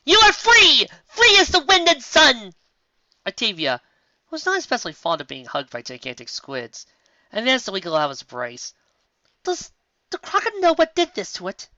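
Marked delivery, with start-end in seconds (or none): 0.0-2.5 loud, high, drawn-out battle cry; yelled. Acted. Lyra yells the famous line across the lake, loud and slow. 9.4-11.7 high character voice. Acted.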